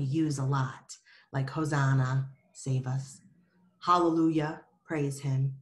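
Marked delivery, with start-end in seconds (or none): none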